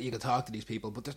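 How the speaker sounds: ordinary delivery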